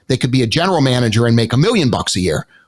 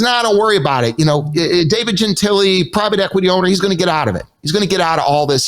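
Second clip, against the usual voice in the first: higher-pitched, breezy reassurance; acted brush-off; 6 semitones higher, faster than usual. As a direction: wave the worry away as someone else. Acts out Kevin brushing off worries.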